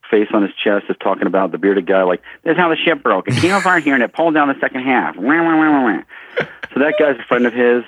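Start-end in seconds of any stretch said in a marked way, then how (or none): 2.4-5.2 higher-pitched mimicry of an eager explainer; lightly put-on explaining voice, pitched up; mimicked explainer. Friend of the director explains how the ship broke, mimicked explainer. 5.2-6.0 higher-pitched wah-wah imitating someone's chatter; mock babble wah-wah. Imitating the bearded guy's explanation trailing on, mock babble. Mocking the man's droning explanation.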